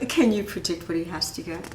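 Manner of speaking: plain reading voice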